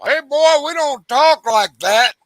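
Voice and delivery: high, loud, drawn-out mock scolding; scolding daddy. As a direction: play a father telling off his boy, slowly. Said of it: "his daddy looked at him and go".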